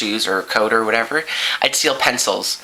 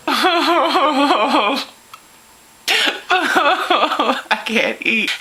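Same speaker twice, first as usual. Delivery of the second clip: high-pitched hammed-up wail; mock crying; 13 semitones higher, much slower than usual. Kid at dinner dreading father, mock bawling. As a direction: bawl the words like a terrified little kid.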